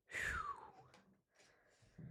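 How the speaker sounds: soft, airy whew; blown-out whew. Real, not acted. Reader catching breath after a list, blown-out whew. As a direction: let out a quiet whew.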